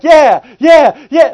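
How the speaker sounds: high, loud string of yeahs; eager agreement. Acted. Abram going along with God's promises, eagerly. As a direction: play an over-eager yes-man.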